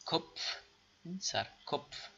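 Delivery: کپف whispered as KPF; whispered drill; whispered demo word. Shown as a demonstration. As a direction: breathe out کپف with pf blend. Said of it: "یعنی".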